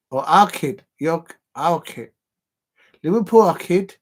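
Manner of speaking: loud, high-pitched mock Scouse; attempted Scouse accent. Shown as a demonstration. Fan correcting himself to our kid, exaggerated Scouse accent. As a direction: put on a big, lilting Scouse accent. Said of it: "a Scouser's accent".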